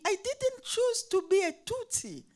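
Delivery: high-pitched pleading protest; heated protest. Retold or imitated. Teacher protesting to her pupil, high and insistent. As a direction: push back, voice jumping high.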